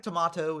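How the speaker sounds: "tomato" with a broad AH, tuh-MAH-toe; British pronunciation. Shown as a demonstration. Commentator citing the British form, "tomato" with AH. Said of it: "tomato is very British".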